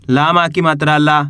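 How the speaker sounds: ordinary delivery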